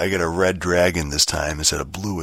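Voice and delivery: ordinary delivery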